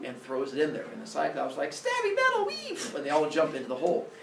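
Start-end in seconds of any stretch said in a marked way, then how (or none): 1.7-3.0 put-on very high squealing kid voice; gleeful children. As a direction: squeal happily as you leap in.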